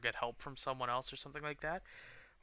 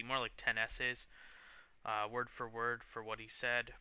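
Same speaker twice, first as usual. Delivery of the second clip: usual speaking voice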